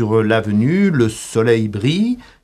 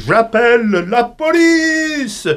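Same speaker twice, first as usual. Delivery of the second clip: high, slow call to the crowd; a hint of an alarmed passer-by yelling; lightly voiced bystander shouting; bystander's cry; 9 semitones higher, 3 dB louder, slower than usual. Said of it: "me crie".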